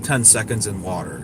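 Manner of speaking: usual speaking voice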